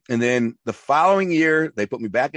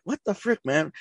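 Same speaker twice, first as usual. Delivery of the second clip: high, softer what the frick; exasperated teen, pitched up; mock disbelief; 8 semitones higher, 4 dB quieter than usual. Hungry kid to his stingy dad, high incredulous retort. Reacting to dad's crackers and cheese.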